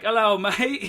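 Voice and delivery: loud, high, hammed-up Australian greeting; fake Australian accent. Drunk sailor bluffing Shore Patrol, fake Australian accent. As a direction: put on a stupid Australian accent. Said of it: "keep acting like I'm Australian".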